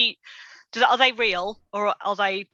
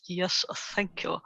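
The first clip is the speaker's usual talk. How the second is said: posh voice; 3 semitones lower, 9 dB quieter than usual. Retold or imitated. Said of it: "Dan's posh voice".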